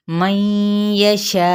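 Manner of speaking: मन यशा with the final vowel stretched very long and cut off with a jolt; long madd with a jolt at the end; six-count madd. Shown as a demonstration. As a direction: draw out यशा, then stop sharply. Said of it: "कैसे?".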